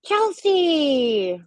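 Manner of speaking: drawn-out call across the room. Real, not acted. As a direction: call her name loudly, high. Calling out to chat viewer.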